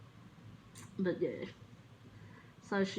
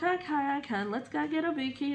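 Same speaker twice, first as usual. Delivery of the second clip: high fond mum voice, quick; imitating mum; 8 semitones higher than usual. Mum agreeing to fetch dog a biscuit, high cooing. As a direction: give in sweetly to the dog. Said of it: "mum's like".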